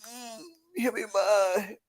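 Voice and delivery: slow, soft teasing voice; sultry mock. Joking about little Cody Rhodes, teasing. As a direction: bite the lip and tease. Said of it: "lip bite".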